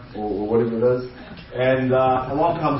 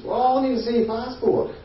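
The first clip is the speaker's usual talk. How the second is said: put-on high-pitched young officer; pimply newcomer's high voice; young customs officer; 10 semitones higher than usual. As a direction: ask officially in a high, youthful voice. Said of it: "pimples, young".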